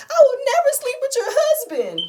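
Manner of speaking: very high, loud mock shriek; imitated screaming. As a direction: scream it out, mimicking an outraged friend. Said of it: "because she's screaming".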